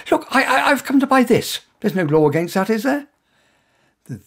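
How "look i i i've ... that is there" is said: heightened panic. Acted. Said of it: "My panic heightened".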